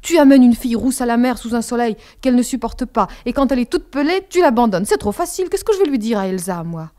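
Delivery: loud, accusing outburst at her father; angry reproach. A narrator's reading. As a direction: hurl the reproach at him. (reproche furieux et fort)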